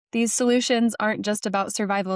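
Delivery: usual speaking voice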